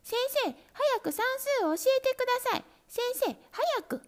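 high, fast, eager kitten voices; kittens calling. A narrator's reading. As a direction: pester the teacher, all together. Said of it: "子供らが叫びました".

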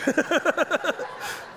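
a high-pitched burst of real laughter. Real, not acted. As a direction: cackle at his own confession. Big laugh after his confession.

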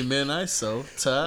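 "Minnesota" drawn out as men-EYE-sota; punning pronunciation. Retold or imitated. Host echoing the pun, "Minnesota" as men-EYE-sota. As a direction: slowly say "Minnesota" with "eye" in the middle. Repeats the pickup-line pun.

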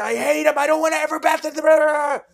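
put-on screaming, high and loud like a terrified kid; childish scream. Little brother screaming at the sibling from the bathtub. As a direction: scream it out like a sobbing little boy. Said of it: "I'm just, like, screaming".

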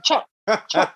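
put-on tiny high-pitched kid voice; squeaky toddler chirp, imitated; imitating little girl. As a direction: mimic a small child's sharp little chops. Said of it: "So she be like".

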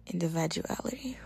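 whispered individuality. Real, not acted. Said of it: "That's crazy".

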